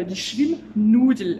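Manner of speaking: teacher presenting a German word slowly and loudly; loud slow demo. Shown as a demonstration. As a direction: announce the new word slowly for learners. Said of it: "Je vais t'apprendre du vocabulaire".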